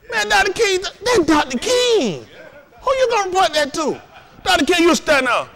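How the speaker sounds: lightly hammed-up adoring church lady; swooning over Doctor King. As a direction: sigh his name like a starstruck fan. Riffing on Doctor King's voice.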